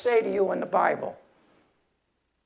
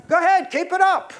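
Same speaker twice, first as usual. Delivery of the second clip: sarcastic dare; 7 semitones higher, 5 dB louder than usual. Real, not acted.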